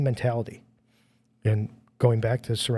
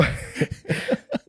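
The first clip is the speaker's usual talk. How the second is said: high breathy laugh. Real, not acted. Laughing at own joke.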